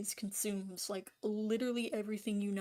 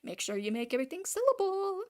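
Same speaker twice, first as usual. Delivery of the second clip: mock know-it-all elder, climbing in pitch to the last word; older person advising; 2 semitones higher, faster than usual. Older person telling young artists to sell, mock breezy advice. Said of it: "every single older person in their life is telling them".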